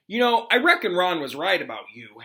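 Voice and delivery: suspicious. Acted. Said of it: "Harry told Crookshanks suspiciously".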